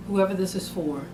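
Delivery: ordinary talk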